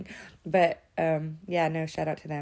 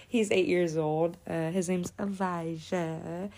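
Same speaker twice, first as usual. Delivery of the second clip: usual speaking voice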